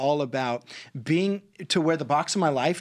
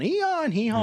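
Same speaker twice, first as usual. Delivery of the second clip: higher-pitched mock hee-haw; freaking-out donkey bray; 9 semitones higher than usual. Storyteller mimics the donkey stuck in the well, freaking out. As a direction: imitate a panicked donkey braying. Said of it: "he's freaking out".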